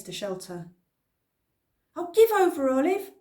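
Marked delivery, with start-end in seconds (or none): 2.0-3.1 put-on high, loud exasperated boy; cried out. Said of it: "Cliff cried".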